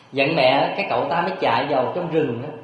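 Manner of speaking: normal talking voice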